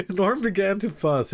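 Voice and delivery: high, loud, slow through laughter; reading through laughter. Real, not acted. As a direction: keep laughing as you read the line. Laughing while reading the baby scene.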